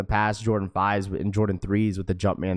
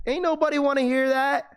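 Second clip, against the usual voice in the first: high emphatic exclamation. Acted. Mocking the boring greeting.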